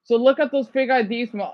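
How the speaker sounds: normal talking voice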